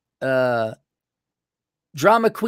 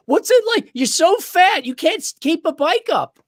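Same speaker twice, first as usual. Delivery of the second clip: high, loud mocking jeer; mock taunt; 13 semitones higher, 5 dB louder than usual. Rider mocking a fat biker who tipped over, high mocking jeer. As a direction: jeer at him like a schoolyard bully.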